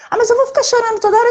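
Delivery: high, fast mock complaint, imitating a mother who resists crying; quick high-pitched parody of the excuse; mock whiny objection. Mocking the mothers in her audience, high and rushed.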